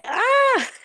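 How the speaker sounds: high, loud delighted "ah"; high happy yelp; excited squeal. Real, not acted. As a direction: squeal with excitement at greeting viewers. Excited greeting as stream goes live.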